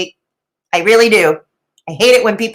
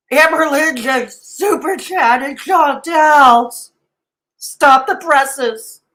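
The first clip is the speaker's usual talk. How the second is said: slow, high mock dismay; mock scandal; 3 semitones higher, slower than usual. Reactor mocking fuss over a super chat, mock scandal. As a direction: draw out the fake breaking news. Mock horror at trivial donation news.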